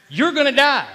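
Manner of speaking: loud, high proclamation of doom; insistent dark thought. Retold or imitated. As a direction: hurl it out like an accusing enemy. Enemy's thought, escalating.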